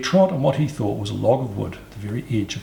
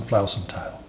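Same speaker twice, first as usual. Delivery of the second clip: usual speaking voice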